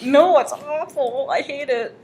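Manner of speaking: high, slow hammed-up despair; mock anguish. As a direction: play the wounded victim.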